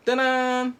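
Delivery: high bright fanfare ta-da; triumphant ta-da. Pretend. Showing off the award with a high ta-da. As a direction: present yourself with a flourish.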